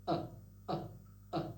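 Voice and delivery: sharp throat-catch uh sounds; repeated glottal catches; glottal stop demo. Shown as a demonstration. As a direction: close the throat and release, three times. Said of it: "Use the glottal stop like this".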